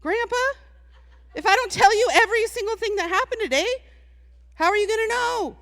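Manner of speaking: put-on high, quick little-girl voice; a cheeky child talking back to grandpa, high and fast. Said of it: "and I was like".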